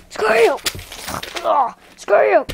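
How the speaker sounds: high, loud attack shouts; high-pitched explosive yell; battle cry. Pretend. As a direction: yell each one as a play attack. Repeated play attacks.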